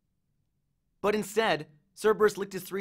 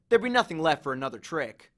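usual speaking voice

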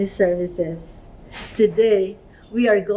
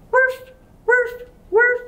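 woof woof in a high, yapping dog voice; high dog barks; slower than usual. Shown as a demonstration. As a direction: bark like a little dog. Teacher voicing dog sound.

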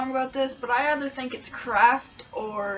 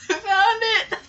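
high, loud laughing exclamation; 12 semitones higher, 7 dB louder, slower than usual. Real, not acted. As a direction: squeal it through real laughter. Blindfolded fumbling, laughing.

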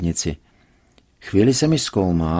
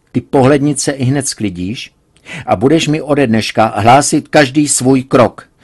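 stern order; 4 semitones higher, 6 dB louder than usual. A narrator's reading. Father lays down orders.

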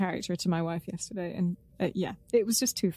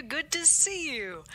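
put-on American accent; 6 semitones higher, slower than usual. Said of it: "why am I gone American?".

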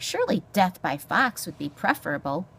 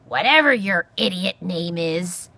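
loud, exasperated porcupine; cantankerous cry; 3 semitones higher, 7 dB louder than usual. A narrator's reading. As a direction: demand it loudly, rising in pitch. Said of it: "Aerith cried".

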